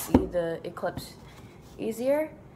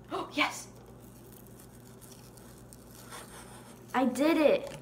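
delighted gasp; 7 semitones higher, 4 dB quieter than usual. Real, not acted. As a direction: gasp then excited cheer. Finally pierced the paper.

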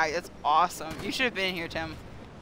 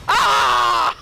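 high, loud game-time scream; loud yell; 7 semitones higher, 7 dB louder than usual. Real, not acted. Startled cry in play.

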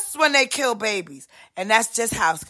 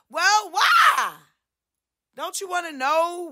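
indignant question; 6 semitones higher than usual. Acted. Put-on voice objecting.

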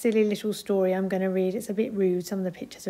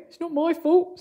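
goat voice; 7 semitones higher, 5 dB louder than usual. A narrator's reading.

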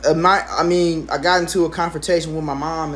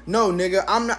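ordinary talk, nothing marked

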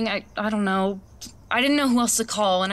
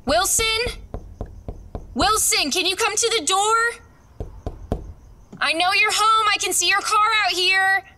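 high, raised call through the door; 12 semitones higher than usual. Acted. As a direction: call out to the neighbour at his door. Calling at neighbor's door.

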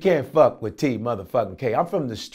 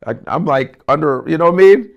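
mock cop shout; 2 semitones higher, 9 dB louder, faster than usual. Comedian miming a cop ordering hands up, loud mock shout. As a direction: bark it like a cop at a stop.

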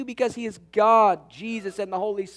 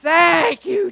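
higher and louder joyful shout of thanks; 9 semitones higher, 8 dB louder than usual. Retold or imitated. Husband retelling his joyful shout when the love note arrived, loud and high. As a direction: burst out with grateful relief. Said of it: "I said".

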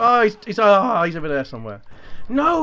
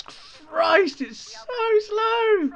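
raging gamer; much slower than usual. Real, not acted. Frustrated at slow controls.

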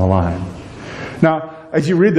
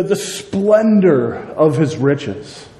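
ordinary delivery

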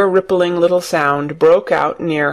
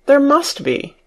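high-pitched insistent protest; crying out; 9 semitones higher than usual. A narrator's reading. Mary refuses to believe there's no door, crying out. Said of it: "cried Mary".

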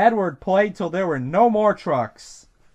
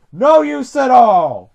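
loud, slow, higher dismissal; grumpy storybook engine; dismissive; 5 semitones higher, 9 dB louder, slower than usual. A narrator's reading. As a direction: refuse help loudly and slowly. Gordon dismisses the offer.

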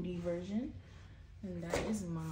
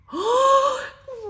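sharp, high delighted gasp; excited gasp; 11 dB louder than usual. Real, not acted. Vlogger opening an album, a high delighted gasp. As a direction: gasp at a cute photo card.

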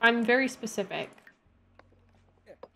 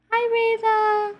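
cooing pet voice; 12 semitones higher, slower than usual. Real, not acted. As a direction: coo the cat's name. Baby talk to the cat.

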